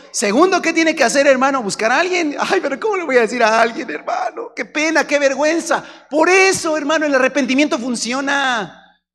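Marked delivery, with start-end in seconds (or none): 2.4-5.8 high, loud ashamed whine; whiny embarrassed sinner. Acted. Sinner to the preacher, whining in embarrassment. 6.1-9.0 fiery preaching. Acted. Preaching at full force.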